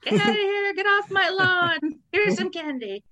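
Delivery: put-on high, loud yell at trespassing kids; hammed-up lawn-guarding shout; mock angry homeowner. Homeowner chasing trick-or-treaters away, mock yelling. Mocks scaring kids off with candy.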